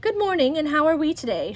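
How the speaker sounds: a gushing neighbor calling good morning; sing-song neighbor. Acted. Neighbor's greeting voiced in story.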